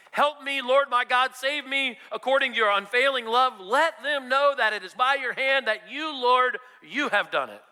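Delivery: fervent plea. Acted. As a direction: lift the psalm as a desperate prayer. Crying out Psalm prayer.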